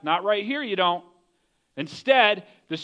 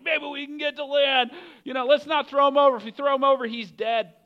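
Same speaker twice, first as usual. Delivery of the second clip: high, louder urgent appeal; urgent straining; 10 semitones higher, 3 dB louder than usual. Acted. Sailors rowing to save Jonah, urgent and straining. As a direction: urge the crew on, pitched right up.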